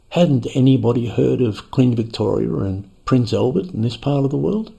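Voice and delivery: ordinary delivery, nothing marked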